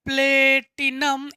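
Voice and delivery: Platinum stretched out syllable by syllable; slow dictation. Shown as a demonstration. Teacher writing platinum for students, slow dictation with long syllables. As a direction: draw out platinum at half speed.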